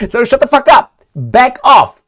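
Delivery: high, loud telling-off; mock yell. Telling off a stranger who comes closer, loud. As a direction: snap at them to back off. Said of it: "telling off the listener".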